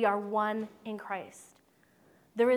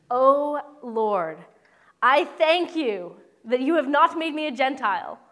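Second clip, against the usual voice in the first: higher and louder, a hammed-up self-satisfied prayer; smug pious prayer; 3 semitones higher, 8 dB louder than usual. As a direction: proclaim it like a proud man thanking God.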